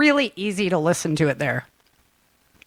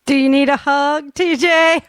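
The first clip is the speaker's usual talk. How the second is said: higher, louder mocking repeat of the line; quoting the line back, high and loud; mocking taunt; 7 semitones higher, 4 dB louder than usual. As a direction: tease TJ with the line. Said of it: "I love that one".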